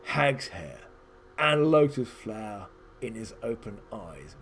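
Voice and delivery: a hint of a wise hermit giving solemn instructions; solemn old wizard advising.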